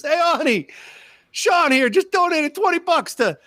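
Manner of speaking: high, boisterous imitated holler; hammed-up call across the shop. Pizza man calls out to wife.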